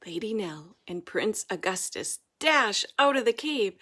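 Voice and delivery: plain reading voice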